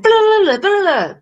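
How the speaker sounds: high loud blah blah; mock babble. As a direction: babble like a runaway mouth. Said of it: "I can be like".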